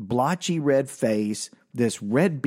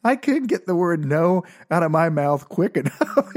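words bubbling with laughter; high giggly retelling; laughing; 5 semitones higher than usual. Real, not acted. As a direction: laugh while telling it.

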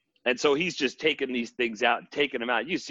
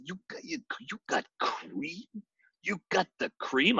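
awed Macho Man; 7 semitones higher, 7 dB quieter than usual. Retold or imitated. Macho Man amazed by creamer.

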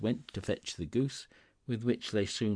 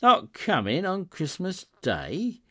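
dismayed; 11 semitones higher, 7 dB louder than usual. A narrator's reading. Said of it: "sudden declension of his high spirits".

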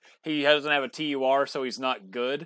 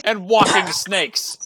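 loud and slow, exasperated; mock outraged rant; 5 semitones higher, 10 dB louder, much slower than usual. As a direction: drag it out in mock horror. Continuing the spider rant.

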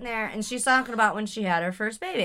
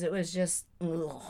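ordinary delivery

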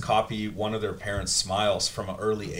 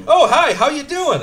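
high, loud put-on cheery voice; fake cheerful greeting; 8 dB louder than usual. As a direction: chirp it like a fake-friendly hello. Mock cheerful greeting quote.

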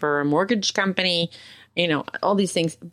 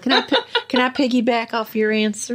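playful put-on country accent, higher; 4 semitones higher than usual. Co-host piggybacking on her friend's answer, playful country accent. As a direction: ask with a hammed-up country accent. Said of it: "still kind of with the country".

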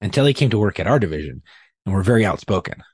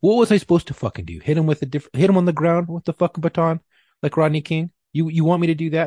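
high, heated retelling of yelling at the bosses; retold angry yelling; 7 semitones higher than usual. Cop retelling how he yelled at his supervisors, raised and heated. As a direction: replay the shouting match, raised and heated. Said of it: "And I was getting angry, I'm legit, like, yelling at them".

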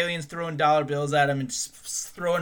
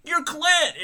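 heckling yell; 5 dB louder than usual. Pretend. Heckling alien crowd.

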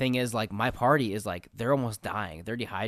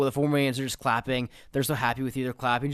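ordinary talk, nothing marked

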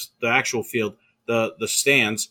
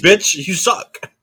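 higher, louder hammed-up snap; mock angry insult; 8 semitones higher, 6 dB louder, slower than usual. A friend voicing the comeback to the football kid, higher and louder. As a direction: spit it out like a playground taunt. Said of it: "It's like".

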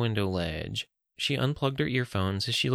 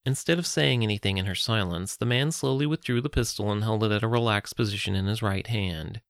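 usual speaking voice

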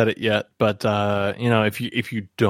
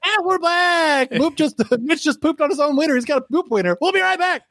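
much higher, over-the-top DJ; cheesy morning show host; radio host voice; 12 semitones higher, faster than usual. Shown as a demonstration. Hammy radio host segment.

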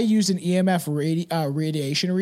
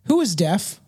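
ordinary talk, nothing marked

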